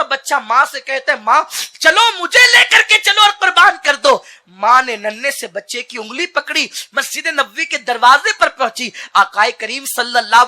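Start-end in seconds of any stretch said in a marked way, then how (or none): 1.2-4.2 high, loud pleading cry; child's plea cried out. Acted. Little boy begging his mother, cried out loudly.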